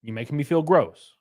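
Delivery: normal talking voice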